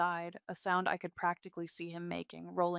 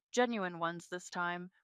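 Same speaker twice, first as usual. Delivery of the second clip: usual speaking voice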